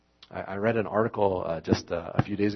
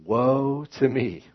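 crying out; 6 semitones higher, 8 dB louder, much slower than usual. Acted. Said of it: "I cried".

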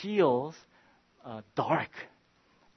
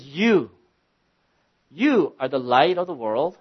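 You hit hard and louder, then a pause before the rest; stress on you; 2 semitones higher, 9 dB louder than usual. Shown as a demonstration. Pastor stressing to the church that You means them, emphatic. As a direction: punch the word You as the key word. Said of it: "You is emphatic".

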